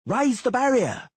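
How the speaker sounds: high, loud, slow command; shouted order. A narrator's reading. Decurion ordering halberdiers, loud shouted command. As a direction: bark it across the bridge. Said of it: "shouted to the halberdiers".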